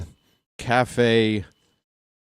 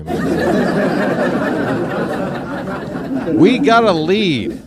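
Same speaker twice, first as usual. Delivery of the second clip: laughing; 6 semitones higher, much slower than usual. Real, not acted. Celebrating the lead, laughing.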